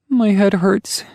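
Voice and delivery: put-on high child voice holding back tears; a hurt girl trying to keep it together; sniffling little girl. A narrator's reading. Girl answers her father, high sniffling child voice. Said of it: "sniffling as she tries".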